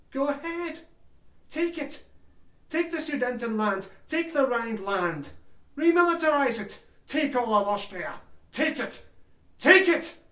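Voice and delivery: high, urgent goading, building to a shout; the world urging. Acted. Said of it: "The world said".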